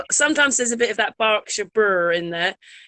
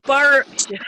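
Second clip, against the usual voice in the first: slow drawn-out Berkshire burr on Berkshire; slow Berkshire country burr; 4 semitones higher, 5 dB louder than usual. Shown as a demonstration. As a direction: roll the r in Berkshire like a Berkshire local. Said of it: "Berkshire's got the burr".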